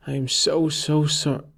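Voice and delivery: voice breaking. Acted. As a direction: apologize as the voice cracks. Jake's broken-off apology.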